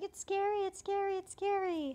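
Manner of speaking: high imitated fright; mock scared. Translator voicing Pierce scared of Nyanko. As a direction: whimper it like a frightened kid. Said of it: "He's like".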